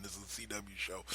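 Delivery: ordinary talk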